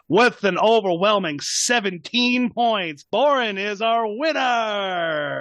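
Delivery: announcing the winner. Acted. Mephisto crowning the winner, slow grand announcement. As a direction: stretch out the winner's name like a ringmaster. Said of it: "and he goes".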